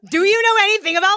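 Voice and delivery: high, fast spooky question; quick, eerie ghostly squeal. Acted. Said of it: "I gotta use my ghost voice".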